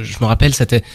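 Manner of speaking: ordinary talk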